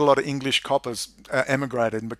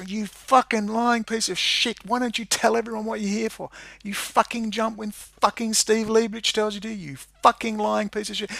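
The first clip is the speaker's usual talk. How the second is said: reenacted abuse; 7 semitones higher, 3 dB quieter than usual. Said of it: "I abused her for twenty solid minutes".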